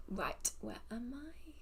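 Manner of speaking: breathy whisper, slowed, to herself; soft private murmur while looking for the place. Real, not acted. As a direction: whisper it under your breath while searching. Muttering while searching her cards.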